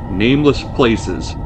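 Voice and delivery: plain reading voice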